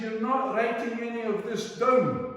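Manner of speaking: mock-scold him from on high like a matriarch; imperious Scottish lady.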